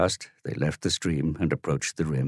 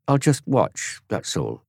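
wheedling; 7 semitones higher, slower than usual. Acted. Dorian tries another tack.

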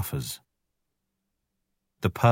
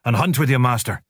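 higher, sharp angry dismissal; snapping wolf; 5 semitones higher, 3 dB louder than usual. Acted. Father Wolf snapping at the jackal to leave. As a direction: throw him out curtly. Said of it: "snapped Father Wolf".

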